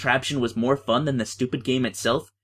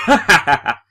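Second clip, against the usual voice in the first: loud gloating laugh; Kirk laughing; 5 semitones higher, 9 dB louder than usual. Acted. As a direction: laugh in smug triumph. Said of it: "And Kirk's like".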